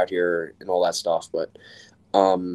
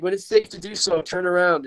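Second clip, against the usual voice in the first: higher, friendly cop voice; mildly high officer giving directions; imitating the officer; 8 semitones higher than usual. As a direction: lift the pitch like the kind officer.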